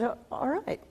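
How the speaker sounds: soft grudging okay; reluctant agreement. Retold or imitated. Mother grudgingly agrees to open the show, quiet. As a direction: give in quietly. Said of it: "And she said".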